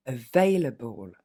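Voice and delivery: available slowly, weak schwa on the able; slow model word. Shown as a demonstration. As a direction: stretch out available, able unstressed. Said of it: "trois exemples ensemble". (available lentement, able sans accent)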